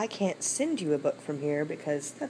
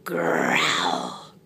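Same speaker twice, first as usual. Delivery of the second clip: very low, rolling growl; growling tiger; 13 semitones lower than usual. Acted. Tiger stuck in deep hole, low growl. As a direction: growl the word itself, drawn out.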